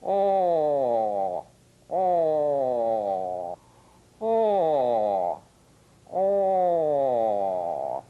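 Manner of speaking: oh with rounded lips. Shown as a demonstration. Teacher demonstrating a rounded vowel oh. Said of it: "rounded lips for rounded vowels".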